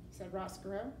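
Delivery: ordinary delivery, nothing marked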